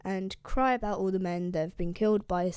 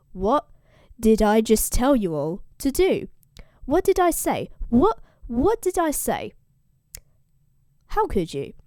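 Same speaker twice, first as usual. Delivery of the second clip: angry scolding; 2 semitones higher, 7 dB louder than usual. Retold or imitated. Odysseus scolds the crew who ate the cattle, angry. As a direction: berate the crew, hitting each what.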